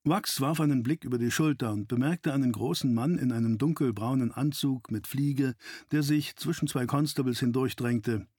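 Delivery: usual speaking voice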